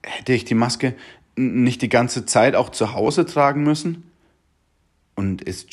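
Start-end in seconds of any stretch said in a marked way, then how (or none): none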